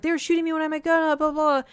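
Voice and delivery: very fast, high tumbling protest; rushed complaint. Retold or imitated. Upset kid telling brother what happened, rushed high complaint. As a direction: blurt it all out at once.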